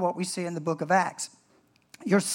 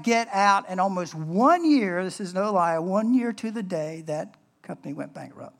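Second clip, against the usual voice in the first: normal talking voice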